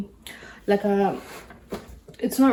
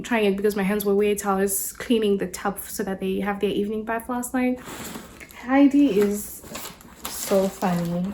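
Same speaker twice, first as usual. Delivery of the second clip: ordinary talk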